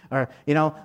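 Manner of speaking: ordinary talk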